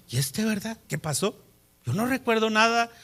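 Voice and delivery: puzzled murmur. Retold or imitated. Wife quietly puzzling over her grumpy husband, hushed. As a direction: wonder under the breath what happened.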